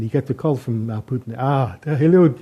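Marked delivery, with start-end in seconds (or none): 1.4-2.3 mock Russian accent. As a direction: greet him like a mock Putin in a thick Russian accent. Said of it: "excuse my Russian accent".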